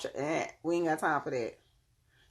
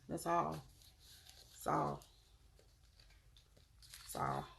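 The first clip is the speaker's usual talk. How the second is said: each "That's all" softer and softer until it almost vanishes; fading repeats; 12 dB quieter than usual. Real, not acted. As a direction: let the words die away to a hush.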